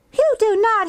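high-pitched little-sister denial; indignant protest. A narrator's reading. Henrietta to Kim, indignantly denying it. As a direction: fire back in a high, upset child voice.

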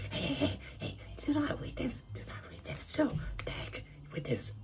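hissed urgent whisper. Retold or imitated. Kid voicing Joey warning viewers, urgent whisper. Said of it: "Like that".

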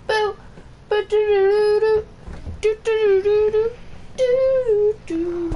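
playful high-pitched doo-doo scat; silly high scat. Real, not acted. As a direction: goof around with a high scat. Silly noises while streaming.

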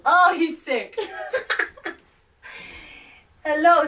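ordinary delivery

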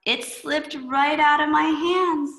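high, slow, sing-song cartoon kid voice; a clumsy kid pleading it was an accident; high, slow kid apology; dismayed Klutzy Boy.

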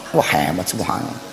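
hushed awe. Acted. Wife's hushed awe at husband.

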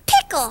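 "pickle" said in the everyday American way; the other pronunciation. Shown as a demonstration. Friend showing Kiki how she says it, American-accented "pickle". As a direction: answer with your own American "pickle". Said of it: "No, I say pickle".

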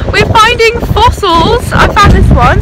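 loud and high in the wind; shouting over wind. Real, not acted. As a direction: raise your voice over gusts. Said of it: "I don't know if you can hear us".

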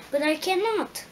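Dorothy protesting in surprise; surprised exclamation. Acted. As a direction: blurt it out in shock. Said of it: "exclaimed Dorothy, greatly surprised".

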